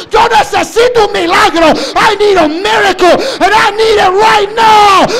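high, loud, desperate shouted plea. Acted. Believer begging the Lord for a miracle, desperate shouting. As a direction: cry out as if nothing else matters. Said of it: "viniste desesperado y tú dijiste".

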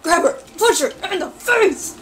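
loud, high urgent commands; carried-away yelling. Real, not acted. Player getting too into the fight, yelling. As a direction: yell orders, far too into the game. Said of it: "I'm getting too into this".

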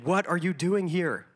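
loud, slow demanding shout; retold yell again. Witch leader repeats her challenge to the frozen intruder, shouting. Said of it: "And she yells it again".

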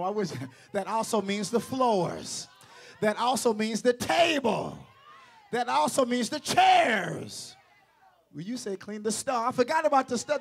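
loud, rising preacher's shout; fired-up pulpit shouting; preaching at full force. Acted.

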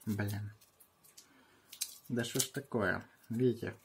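normal talking voice

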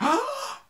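sharp high gasp; shocked group gasp. Retold or imitated. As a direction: gasp in scandalized surprise. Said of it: "everybody was like".

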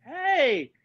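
high hey at an ordinary volume; answering greeting. Retold or imitated. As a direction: echo the hello up high, without the volume. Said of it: "And I go".

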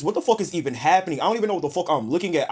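ordinary talk

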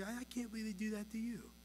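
put-on lightly childish commiserating; childish sympathy. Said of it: "we sound like a bunch of preschoolers".

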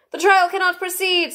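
high, solemn kingly voice; grave King. A narrator's reading. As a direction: pronounce it gravely from the throne. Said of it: "the King in a very grave voice".

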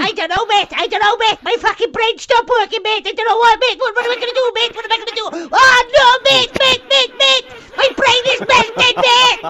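high, whiny parody of a flustered friend; mocking imitation. Mocking teammate's flustered words.

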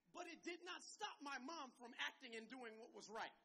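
ordinary talk

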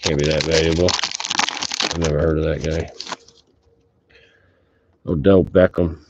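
ordinary delivery

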